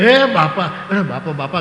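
mock call to father through a window, loud and high. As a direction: shout up to the stuck father. Son calling father through window.

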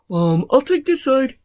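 high, loud storybook character; eager character voice. Acted. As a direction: pipe up to volunteer.

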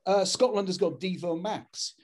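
ordinary delivery

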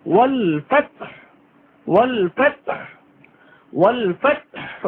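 ফাতহ ending in a breathy throat ha; throaty ha. Shown as a demonstration. Teacher demonstrating the throat ha in ফাতহ. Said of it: "বড় হা উচ্চারণে গলায় শোষ".

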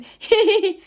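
high, loud put-on "hee-hee-hee"; short squealed fake giggle; imitated mocking laugh. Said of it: "এমনি করে হাসি দি".

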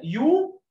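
يو said alone, high and loud; isolated syllable yu. Shown as a demonstration. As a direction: sing out يو as its own syllable. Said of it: "أبي زي كلمة يو".

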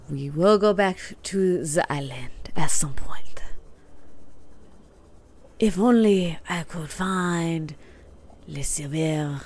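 badly faked French accent; terrible French accent. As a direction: put on a hammy, halting French accent. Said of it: "That was a terrible French accent".

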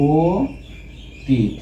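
অতীত very slowly as "oo... tit"; syllable-by-syllable pronunciation. Shown as a demonstration. Teacher dictates for writing, অতীত slowly as "o... tit". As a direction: stretch the o of অতীত, then pause before "tit". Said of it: "তাহলে এটা হবে".

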